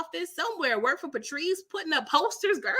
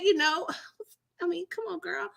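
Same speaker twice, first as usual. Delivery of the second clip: usual speaking voice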